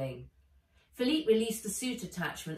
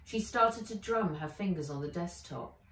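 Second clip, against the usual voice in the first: ordinary delivery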